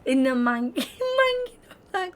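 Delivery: high laughter chopping the words apart; real helpless laughing fit; can't stop laughing. Real, not acted. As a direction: keep trying and failing to finish through giggles. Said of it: "I can't stop laughing".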